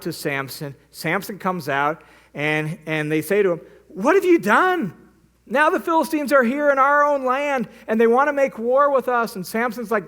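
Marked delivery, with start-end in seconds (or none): 3.9-9.3 high, raised voice, mock panic; alarmed accusation. Villagers of Lehi confronting Samson, alarmed and much higher. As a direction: voice the villagers' frantic reproach.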